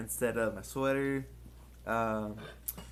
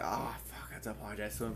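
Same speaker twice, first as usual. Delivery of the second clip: hushed whisper to himself, a touch alarmed; breathy inner voice realizing trouble; whispered thought; 6 semitones lower, 10 dB quieter than usual. Retold or imitated. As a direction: whisper it like a panicked private thought. Inner thought voiced on seeing him.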